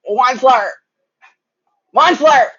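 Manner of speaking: loud, high croaky calling; croaking call. A narrator's reading. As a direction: call out hoarsely across the room. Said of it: "he cried with a cruffless croak".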